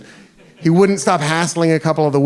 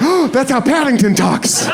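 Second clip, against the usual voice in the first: higher, a mild surprised yelp; startled realization; 7 semitones higher than usual. Retold or imitated. American realizing the drunk sounds like Paddington, surprised. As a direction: blurt it out delighted and startled.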